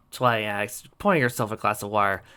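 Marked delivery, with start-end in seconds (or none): none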